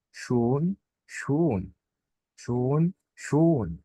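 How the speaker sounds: شون with rounded lips, long ö; German umlaut ö, lips pursed and held long. Shown as a demonstration. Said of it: "بضم تجويف بقي شوية أكتر".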